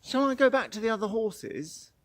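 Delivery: horse's question. Retold or imitated. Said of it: "when it's thinking".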